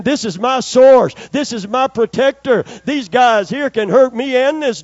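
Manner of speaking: loud, high declaration of faith; fervent declaration. Retold or imitated. Preacher voicing his prayer before the dogs, loud. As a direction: proclaim it with full conviction.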